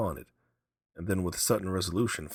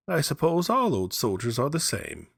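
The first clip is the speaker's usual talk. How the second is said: higher, louder scoffing wife; old mother scoffing at her husband, higher; lightly voiced old wife; 9 semitones higher, 5 dB louder than usual. A narrator's reading. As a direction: brush off the soldier's tales.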